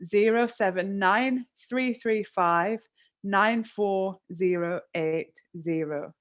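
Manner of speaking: slow, each digit spoken clearly; slowly. Shown as a demonstration. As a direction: dictate the number slowly so viewers can note it. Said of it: "I'll say it again".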